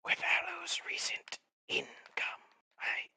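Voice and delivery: hushed secretive whisper. Acted. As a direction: whisper it like a conspirator. Whispering in-character voice.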